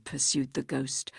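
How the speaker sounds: ordinary talk, nothing marked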